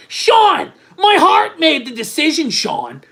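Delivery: high, loud, frantic mock outburst; hammed-up shouting plea. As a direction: shout it as a melodramatic justification. Acting out a frantic plea to Sean.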